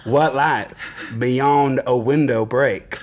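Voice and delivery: slow, badly faked Southern drawl; Southern accent. Shown as a demonstration. Host demonstrates Shakespeare made easy, mock Southern accent. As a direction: read verse in a hammed-up Southern accent. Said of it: "just read it in a Southern accent".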